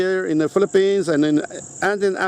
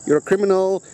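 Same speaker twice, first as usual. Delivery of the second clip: usual speaking voice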